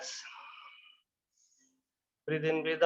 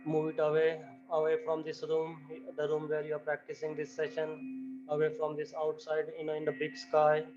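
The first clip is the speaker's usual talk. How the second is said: much softer, hushed meditation voice; guided meditation; 5 dB quieter than usual. Acted. Yoga teacher guiding students into the universe, softly. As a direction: ease them out of their bodies gently.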